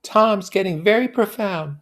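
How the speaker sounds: high, airy mock-serious; high voiced dreamy remark; Daisy, unthinkingly sad. A narrator's reading. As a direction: talk as if not really thinking. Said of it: "unthoughtful sadness".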